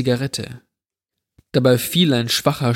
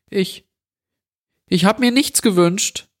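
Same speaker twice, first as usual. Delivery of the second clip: put-on frightened boy, voice jumping higher; the boy forces out a frightened answer to the intruder, trembling and higher; 8 semitones higher than usual. A narrator's reading. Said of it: "mit zitternder Stimme".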